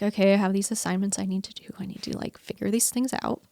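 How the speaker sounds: hushed whisper, a bit quick; whisper to herself. Retold or imitated. Lying in bed, planning her day to herself, whispered. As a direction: murmur the to-do list under your breath.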